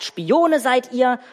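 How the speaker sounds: preacher recalling Josef's accusation; accusing Josef. Retold or imitated. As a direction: lean on the charge like Josef. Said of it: "Josef sagt".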